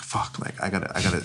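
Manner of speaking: hushed panicky whisper; a soft, hurried whisper to yourself; whispered to himself. Retold or imitated. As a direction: whisper it like you're scheming alone. Thinking to himself, sneaking out.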